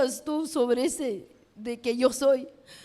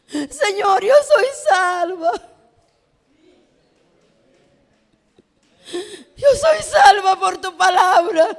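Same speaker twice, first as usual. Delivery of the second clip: raised, loud and tearful declaration to God; impassioned cry; 4 semitones higher, 8 dB louder than usual. Retold or imitated. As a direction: cry it out to the Lord, high and loud. (clamor exaltado a Dios, con voz alta y quebrada)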